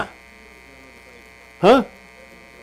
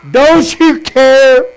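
slow, rising into a frightened shout much higher and louder; panicked cry; 8 semitones higher, 7 dB louder, much slower than usual. Acted. Disciples crying to sleeping Jesus in the storm, panicked shout. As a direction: beg the sleeping master, terrified the boat sinks.

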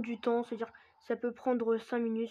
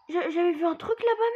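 scared cry; 6 semitones higher, 7 dB louder than usual. Real, not acted. Scared by the discovery.

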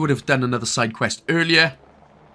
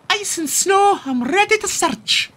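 very high Scottish pup catchphrase. Pretend. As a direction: chirp it like a Scottish cartoon pup. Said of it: "it's just the voice".

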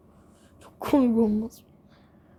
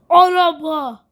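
excited call; 5 semitones higher, 10 dB louder, slower than usual. Real, not acted. Spots the car.

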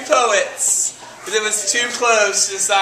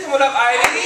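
ordinary delivery

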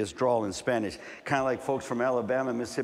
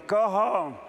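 put-on kids' taunt; 7 semitones higher than usual. Kids taunting a brown child.

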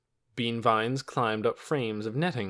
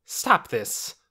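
firm, louder order; firm command; 3 semitones higher, 5 dB louder than usual. A narrator's reading. The doctor grabs Torin's arm, firm command. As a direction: put a stop to it sharply.

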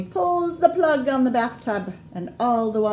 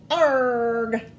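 pirate call; 3 semitones higher, 5 dB louder than usual. Acted. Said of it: "call out her little pirates".

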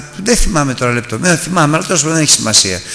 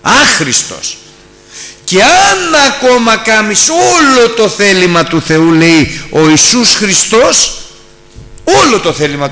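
slow, loud, higher preaching voice; fiery preaching; 5 semitones higher, 5 dB louder, slower than usual. Acted. Preacher warning the congregation, loud and slow. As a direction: drive it home slowly from the pulpit.

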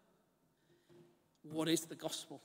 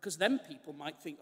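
ordinary delivery

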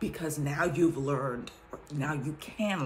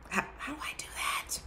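whispered, fast, hushed question; 10 semitones higher, 4 dB quieter, faster than usual. Real, not acted. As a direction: whisper it as a private aside. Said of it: "repeats question whispered".

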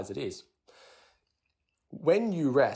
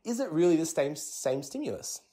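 puzzled wondering; 7 semitones higher than usual. Retold or imitated. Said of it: "scratch our head and go".

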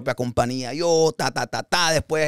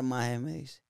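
ordinary talk

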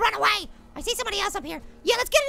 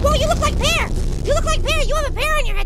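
high, loud amused greeting; excited; 4 semitones higher, 6 dB louder than usual. Real, not acted. Player spotting someone with a pear head, excited. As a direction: raise the voice in delighted surprise.

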